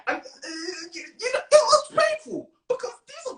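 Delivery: high, hammed-up wail of hurt; mock crying. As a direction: whine it out as if about to sob. Recalling rejection in mock anguish.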